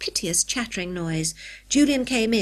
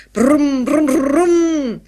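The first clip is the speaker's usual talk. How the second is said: loud, high, drawn-out engine revving; child's noisy vroom; imitated car engine; 6 semitones higher, 10 dB louder, much slower than usual. A narrator's reading. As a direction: rev like a boy pretending to be a car. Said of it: "he's just being a car".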